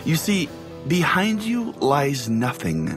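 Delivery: ordinary delivery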